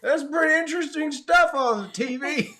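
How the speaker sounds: high, loud hammed-up couch spectator; mock TV viewer. Teasing people who watch with popcorn, mock spectator voice.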